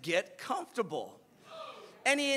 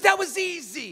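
loud, high-pitched comeback; heated retort; 8 semitones higher, 8 dB louder than usual. Retold or imitated. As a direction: throw it back at him loudly. Acted quarrel, coach retorting.

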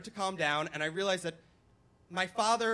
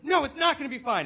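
loud, high-pitched refusal; freaking-out dad; 9 semitones higher, 8 dB louder than usual. Retold or imitated. Dad rejecting son's reassurance, frantic yell. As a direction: snap back in a panic. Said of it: "my dad's freaking out".

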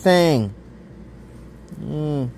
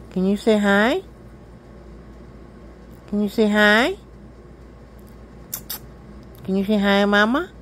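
talking to a cat; 5 semitones higher, 4 dB quieter than usual. Real, not acted. Baby-talking to her cat Milo.

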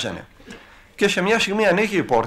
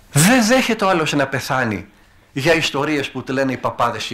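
preaching. Acted. Priest preaching to the flock.

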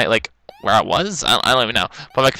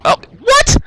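very high, loud squawk; incredulous outburst; 6 dB louder than usual. Real, not acted. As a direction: squawk in disbelief at the game. Game fails on him.

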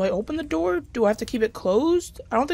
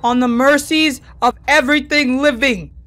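raised-voice begging, drawn-out please; loud pleading; 3 semitones higher, 6 dB louder, slower than usual. Real, not acted. Player begging the game to end, loudly pleading. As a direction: beg loudly for mercy.

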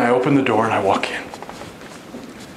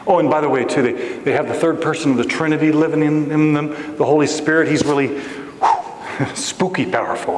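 ordinary talk

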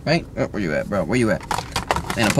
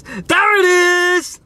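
high, drawn-out screech; long, high mock-crying shout; mock wail; 3 dB louder, much slower than usual. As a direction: wail it in hammed-up horror. Repeats it as a wail.

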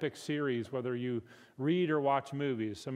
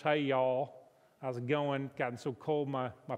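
put-on central Georgia accent. Pastor mimicking someone from central Georgia, Southern accent. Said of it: "someone in central Georgia would speak English".

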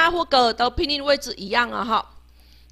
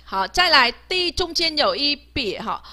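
normal talking voice

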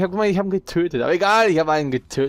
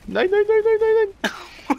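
panicked; slower than usual. Real, not acted. Enemy spotted, panicking.

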